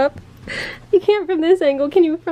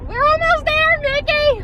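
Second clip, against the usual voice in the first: cooing to a pet; 14 semitones higher, slower than usual. Real, not acted. Owner cooing to her dog asleep in the car, high and tender. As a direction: coax the sleepy dog gently.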